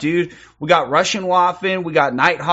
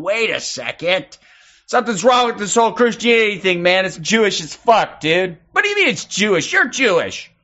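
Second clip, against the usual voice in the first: acted argument voice; 5 semitones higher than usual. Acts out an argument between viewers.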